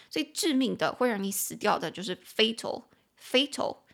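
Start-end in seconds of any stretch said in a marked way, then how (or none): 3.2-3.8 fatal said as two syllables, FAY-tal; teacher models fatal in two beats for learners. Shown as a demonstration. Teacher says fatal in two syllables. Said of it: "fatal，两个音节".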